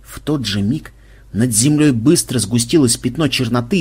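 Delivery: plain reading voice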